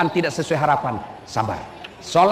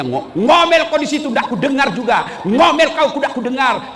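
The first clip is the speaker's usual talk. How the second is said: louder, jeering husband shouting down the well; mocking taunt; 8 semitones higher, 4 dB louder than usual. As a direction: taunt her from the rim of the well. (mengejek istri di sumur dengan suara keras)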